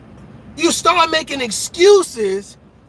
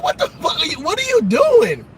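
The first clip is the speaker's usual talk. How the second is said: incredulous outburst; 10 semitones higher than usual. Retold or imitated. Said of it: "I be like".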